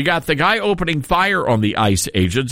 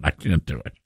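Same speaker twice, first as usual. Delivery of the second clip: ordinary delivery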